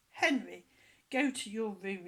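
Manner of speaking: loud furious mum; furious mother shouting; angry shout. A narrator's reading. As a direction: bellow it at the naughty boy. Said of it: "shouted Mum".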